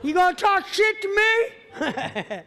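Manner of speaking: hammed-up laughing threat; mock tough challenge. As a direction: play the hard boss daring them.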